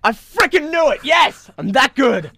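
high, loud victory yelp; gleeful whooping brag; triumphant shout. Real, not acted. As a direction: crow with delight at being proven right. Triumphant reaction to family praise.

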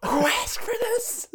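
high, urgent stage whisper; whisper shout. Pretend. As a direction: whisper it loudly across dark seats. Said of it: "that whisper shout".

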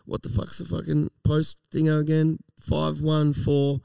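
usual speaking voice

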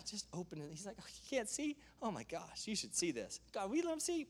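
soft, breathless imitation of Elisha; lightly voiced seer, quiet wonder; hushed prayer. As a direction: murmur it, half amazed. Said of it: "And he says".

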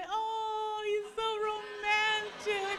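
swooning onlookers. Retold or imitated. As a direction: play the crowd sighing over him. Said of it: "And then everybody would".

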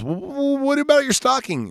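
stammering, very high-pitched plea; squeaky mock desperation; retold desperate call. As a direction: imitate a flustered man calling after her, voice shot way up. Inventor calls after departing reporter.

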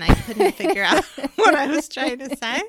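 loud, high burst of laughter mid-sentence; laughing. Real, not acted. Co-host teases about her muddled opening, laughing. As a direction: crack up while saying it. Teasing callback, both laughing.

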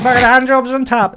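jumps high, delighted disbelief; excited echo. Retold or imitated. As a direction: shriek it with glee. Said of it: "And I'm like".

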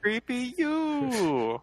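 silly high voice. Retold or imitated. Mock Yakov Smirnov line.

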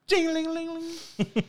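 high trilled ding-a-ling; imitated bell ringing. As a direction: mimic a little door bell. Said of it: "and just go".

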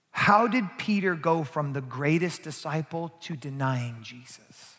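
normal talking voice

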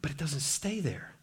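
near-whispered reassurance; hushed. Real, not acted. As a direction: drop right down almost to a whisper. Hushed turn after heaviness.

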